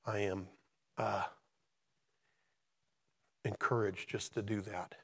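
halting, hushed, with long pauses; emotional. Real, not acted. Preacher sharing recent events in his life, emotional. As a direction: speak softly and haltingly, holding back feeling. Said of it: "I get all emotional about this stuff".

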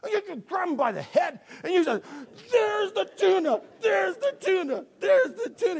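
hammed-up furious cat owner; exasperated yelling. Owner shoves cat's face in tuna.

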